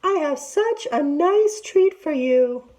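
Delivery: high, sugary put-on auntie voice; sweet fake auntie. A narrator's reading. Tiger to Big Sister, luring her in sweetly. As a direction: lure her in with false sweetness.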